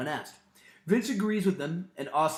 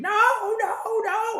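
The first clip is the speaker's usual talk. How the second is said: hammed-up cry of horror; imitated screaming; 5 dB louder than usual. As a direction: stretch each no in a high, half-voiced scream. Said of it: "[name] is screaming".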